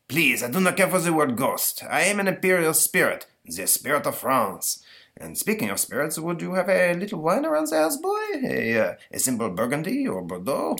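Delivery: put-on haughty ghost Napoleon in a high French accent. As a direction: play a pompous French emperor ghost. Ghost Napoleon speaks to boy.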